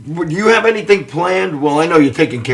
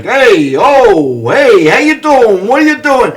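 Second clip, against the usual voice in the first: friend's New York voice; 6 semitones higher, 6 dB louder, slower than usual. Retold or imitated. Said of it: "he's like".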